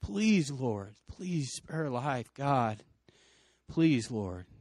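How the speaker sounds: softened, reenacted plea to God; pleading prayer. Group praying over the injured girl, hushed retold pleading. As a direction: pray it gently, with pauses between pleas. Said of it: "We prayed. We said".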